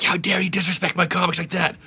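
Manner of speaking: high, strained mock outrage; losing it. Promoter confronts heckler over his comics, imitated fury. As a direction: dress him down, imitating an incensed promoter. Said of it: "he just loses it on this guy".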